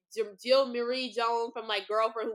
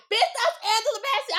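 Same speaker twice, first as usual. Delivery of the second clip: high, loud starstruck shriek; retold excited scream; 12 semitones higher, 6 dB louder than usual. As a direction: squeal it at your friend, thrilled and loud. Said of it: "we were screaming, I'm screaming".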